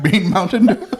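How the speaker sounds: put-on high, questioning Indian-accented "Bean Mountain Dew?". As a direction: say "Bean Mountain Dew?" with Green flattened to a long Bean. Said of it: "I was like".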